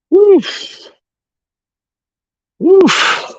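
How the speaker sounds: loud high puff of breath; high exhausted woof. Real, not acted. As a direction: blow out hard after effort. High exhale after exertion.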